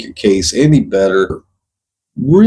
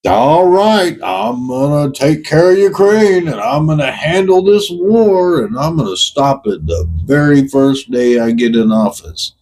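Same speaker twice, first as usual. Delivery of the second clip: higher-pitched mock boast, easing down at the end; mocking the president's boast; 4 semitones higher than usual. Ranter mimics Donnie promising to end the war, mocking. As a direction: parrot his big promise in a raised, derisive voice. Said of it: "you're sitting there like".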